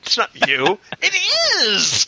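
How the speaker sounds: high, giddy toy-pride voice; excited. Pretend. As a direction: show off the toy gleefully. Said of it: "give them away to people".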